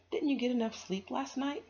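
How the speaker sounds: put-on a teacher checking on a sleepy child; lightly voiced teacher.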